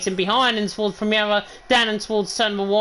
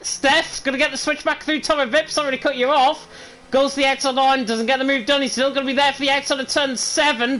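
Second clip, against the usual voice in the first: fast, rising race call; excited play-by-play; 3 semitones higher than usual. Real, not acted. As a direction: call the overtake live. Live play-by-play of battle.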